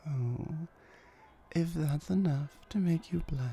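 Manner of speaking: normal talking voice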